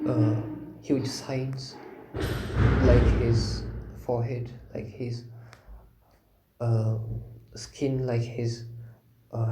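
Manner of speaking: sparse phrases with long, calm silences; guided meditation. Real, not acted. Teacher leading contemplation of Buddha's qualities, meditative.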